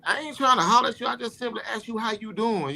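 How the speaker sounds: high, indignant voice of a mocked woman; imitating a huffy woman; mock offended reply. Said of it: "I'm like".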